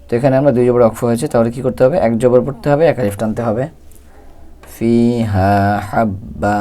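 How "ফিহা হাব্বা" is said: ফিহা হাব্বা chanted very slowly, vowels drawn long; Quran recitation demo. Shown as a demonstration. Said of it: "এভাবে". (তিলাওয়াতের ভঙ্গিতে ধীরে টেনে পড়া)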